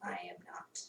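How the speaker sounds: whisper. Pretend. Joking whisper after Yoda line.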